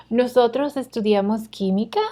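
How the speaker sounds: yes-or-no question lifting at the end of "química"; rising question intonation. Shown as a demonstration. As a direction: read it as a question, tune up on "química". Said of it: "let's practice reading these".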